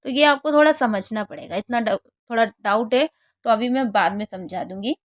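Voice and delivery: ordinary delivery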